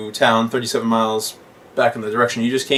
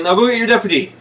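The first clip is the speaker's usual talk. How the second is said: high mock German; 10 semitones higher, 4 dB louder, faster than usual. Quoting the German.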